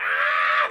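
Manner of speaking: loud imitated wail; babies screaming. Babies burning in their cribs, mock scream. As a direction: scream like terrified babies, hammed up. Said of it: "They're screaming in their cribs".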